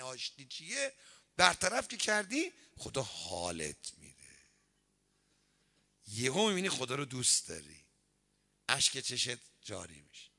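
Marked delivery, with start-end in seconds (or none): none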